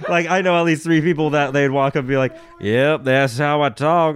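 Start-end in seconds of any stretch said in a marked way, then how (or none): 2.6-4.2 slow, put-on country accent; mocking imitation of a fake country accent. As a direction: play a kid faking a country accent. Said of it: "spoke in a country accent".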